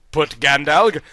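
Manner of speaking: usual speaking voice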